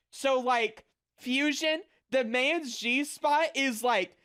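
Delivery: ordinary talk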